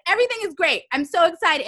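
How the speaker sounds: high, bright-eyed retold excitement. Entrepreneur recalling her pre-pandemic excitement, high and eager. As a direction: play back the giddy early-year joy, pitched high. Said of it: "No, I was like".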